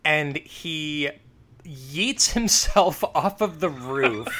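ordinary talk

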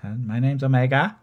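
mock Kiwi accent. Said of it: "almost as bad as my Christopher Walken impression".

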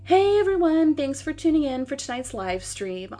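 high, loud perky host hello; livestream greeting. Acted. Guest greeting her livestream viewers, high excited welcome. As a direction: greet the online audience, pitched well up.

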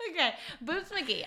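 name it through a laugh. Real, not acted. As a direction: chuckle the nickname out. Laughing at the nickname.

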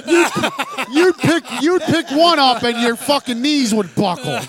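high, loud comeback; heated retort. Real, not acted. Cabinet builder answering a pussy jibe, heated retort. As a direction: fire back loudly at the heckler.

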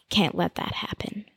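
hushed intimate whisper. Acted. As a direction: whisper it close, unvoiced. Gazing deep into her eyes.

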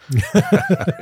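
sudden high laugh; high burst of laughter. Real, not acted. Laughs at childhood memory.

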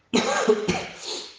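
sudden hacking cough mid-sentence; rough cough breaking the sentence; chesty cough. Real, not acted. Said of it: "mi son preso sta tosse".

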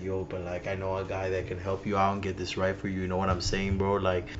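low, soft, fast-talking Miami hustler; hushed, low Miami-guy patter; Miami guy impression. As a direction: drop low and rattle off a favor, bro-style. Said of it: "He kinda talks like this".

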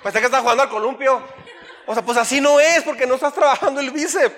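fast, laughing through it; can't hold back the laugh, teasing. Real, not acted. Laughing at gym man's swinging. (riendo mientras cuenta lo del columpio)

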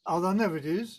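ordinary talk, nothing marked

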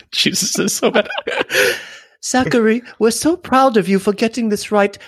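very high, hammed-up fake British voice; horrible British accent. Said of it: "horrible British accents".

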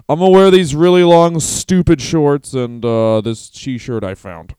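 loud, goofy Kevin Owens voice; loud dim-witted wrestler voice; Kevin Owens impression. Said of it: "So he goes".

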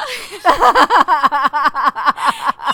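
loud burst of laughter; loud helpless laughter; real laugh. Real, not acted. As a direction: break up laughing. Laughing at own crying story.

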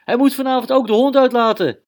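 high, loud teasing shout; older brother yelling across the dinner table, teasing; big brother shouting. Acted. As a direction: Klaas taunts his little brother at the table. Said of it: "zo riep Klaas".

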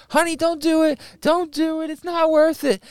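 very high mock-wife voice; pleading wife. Storyteller voicing wife stopping her husband's fight, high pleading. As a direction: imitate a wife begging, high and loud. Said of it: "His wife's there like".

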